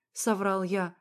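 plain reading voice

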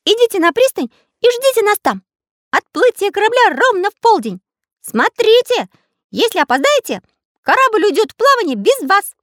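very high, firm character voice; small artist giving orders. A narrator's reading. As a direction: instruct them in a piping high voice.

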